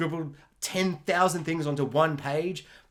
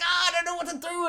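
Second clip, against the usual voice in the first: much higher, fast panicky voice; flustered dad; 13 semitones higher, faster than usual. Retold or imitated. Dad lost with the technology, high and fast. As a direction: babble it like a lost cartoon dad.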